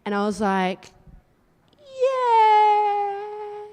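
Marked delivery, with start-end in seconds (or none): 1.8-3.6 mock dread. Uncle's reluctant reply.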